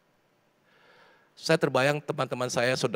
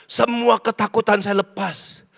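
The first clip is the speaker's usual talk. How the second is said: impassioned preaching; 8 semitones higher, 6 dB louder than usual. Real, not acted. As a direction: preach it high and urgent to a crowd. Preaches at full force. (khotbah berapi-api dengan nada tinggi)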